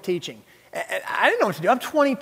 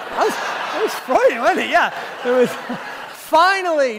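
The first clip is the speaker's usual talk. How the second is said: laughing; 6 semitones higher than usual. Real, not acted. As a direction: crack up at your own slip. Laughing at own Freudian slip.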